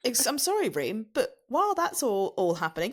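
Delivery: usual speaking voice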